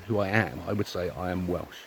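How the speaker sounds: ordinary delivery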